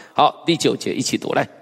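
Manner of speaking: ordinary talk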